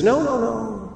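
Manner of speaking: high-pitched mock refusal; breezy acted brush-off; dismissive refusal. Woman waves off rescue in story.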